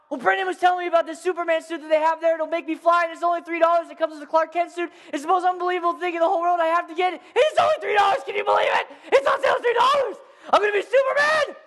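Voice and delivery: put-on high-pitched breakneck child pleading; imitating a boy bursting with news; overexcited kid. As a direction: rattle it off, building to a squealed shout. Excited child explaining to mother.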